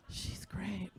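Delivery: unvoiced grudging whisper; whispered aside. Mom whispering a grudging compliment to the crowd. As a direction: breathe it out like a secret.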